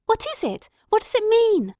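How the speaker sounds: high, urgent, alarmed asking; frightened questions. A narrator's reading. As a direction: ask in rising panic. Terrified at scream on moor.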